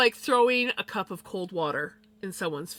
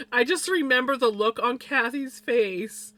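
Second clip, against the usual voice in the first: usual speaking voice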